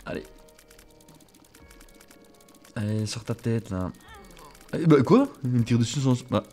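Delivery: usual speaking voice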